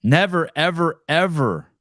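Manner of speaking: insisting it would never be tolerated; emphatic never. Real, not acted. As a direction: hammer home each ever.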